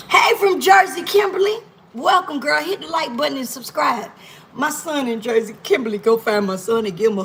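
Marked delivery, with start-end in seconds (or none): 0.1-2.6 high, loud happy hello; excited greeting. Real, not acted. Greeting a viewer from Jersey, excited. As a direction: call out to a new viewer.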